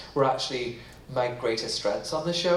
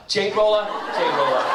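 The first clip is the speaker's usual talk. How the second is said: "Jade roller" punched louder with a laugh; emphatic repeat; 4 semitones higher, 5 dB louder than usual. Shown as a demonstration. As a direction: hammer the words jade roller. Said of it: "I got a jade roller".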